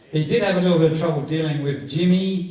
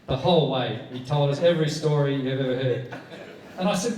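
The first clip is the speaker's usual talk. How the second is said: usual speaking voice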